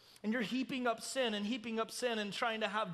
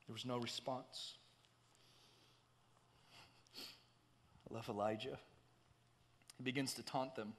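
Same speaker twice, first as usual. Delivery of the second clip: very soft, low sermon voice; hushed preaching; 8 semitones lower, 8 dB quieter than usual. Real, not acted. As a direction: bring the congregation down to a hush.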